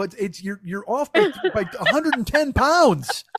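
high, loud, drawn-out correction; exasperated. Retold or imitated. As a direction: build to a slow, loud comic protest. Acted protest building to climax.

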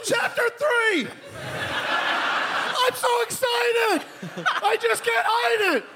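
very high, drawn-out comic shriek; mock yelled reading. Comic imitating fan yelling the entire book aloud, shrieking. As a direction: yell the book out in an absurdly high voice. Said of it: "you yelled the entire book?".

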